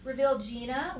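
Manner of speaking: ordinary delivery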